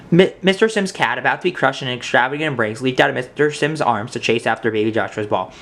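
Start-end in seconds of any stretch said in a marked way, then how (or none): none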